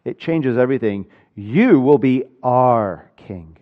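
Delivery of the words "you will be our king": slow line, "our" stretched out and leaned on; stress on our. Shown as a demonstration. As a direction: hold "our" long before "king". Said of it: "when you emphasize the word".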